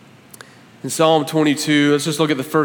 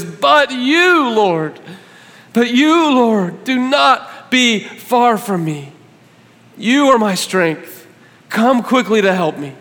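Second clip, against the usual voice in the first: much higher and louder, slow crying out; passionate plea; 6 semitones higher, 4 dB louder, slower than usual. Acted. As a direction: cry out to God for rescue. Preacher loves verse nineteen.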